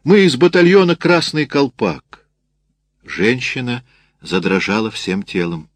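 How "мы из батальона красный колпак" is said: sergeant's shout. A narrator's reading. Sergeant introducing his battalion to a frightened woman, shouting. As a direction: announce the battalion in a ringing shout. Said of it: "крикнул ей сержант".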